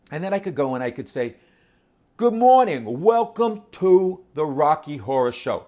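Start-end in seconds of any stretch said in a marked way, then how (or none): 2.2-5.6 louder, higher, slow tough-guy greeting. Pretend. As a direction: greet them like a tough bouncer at the door. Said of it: "This is my tough guy voice".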